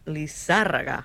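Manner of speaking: "Lizárraga" with the stress on ZÁR. Shown as a demonstration. Host correcting co-host's band name, "Lizárraga" stressed on ZÁR. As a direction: hit the second syllable of "Lizárraga". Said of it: "throwing that little accent in there".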